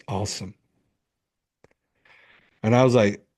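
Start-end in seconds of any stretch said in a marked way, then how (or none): 0.1-0.5 a light, quiet deadpan "Awesome.". Retold or imitated. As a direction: say it with a straight face, unimpressed. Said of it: "Like, straight face".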